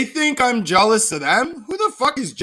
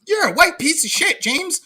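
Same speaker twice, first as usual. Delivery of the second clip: mock Natalie; 9 semitones higher than usual.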